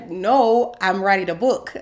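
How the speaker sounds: loud, insistent inner protest. Retold or imitated. She mentally rejects being called an author, protesting. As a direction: push back hard, NO drawn high and loud. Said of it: "I'm thinking".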